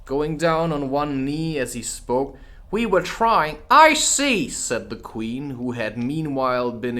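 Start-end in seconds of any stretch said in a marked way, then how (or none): 2.7-3.6 higher pleading gardener; humble gardener. Acted. Two pleads with the Queen humbly. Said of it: "in a very humble tone". 3.7-4.5 much higher, louder queen voice; icy Queen. Acted. The Queen answers the gardener coldly.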